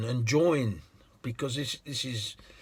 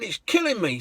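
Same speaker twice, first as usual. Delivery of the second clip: much higher, indignant woman's voice; exasperated lady; 7 semitones higher than usual. Retold or imitated. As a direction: complain with disbelief.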